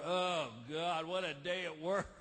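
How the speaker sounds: low, worn-out sigh of a line; weary complaint. Retold or imitated. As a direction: come home tired and grumbling. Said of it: "you walk in the front door".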